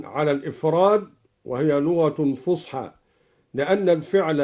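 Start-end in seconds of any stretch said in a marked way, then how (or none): none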